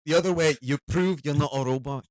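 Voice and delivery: loud, high-pitched Scottish; Scottish accent. Acted. Comic demonstrating a captcha joke, Scottish accent. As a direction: perform it as a Scottish stand-up. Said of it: "I wrote that in a Scottish accent".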